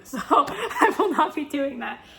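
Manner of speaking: usual speaking voice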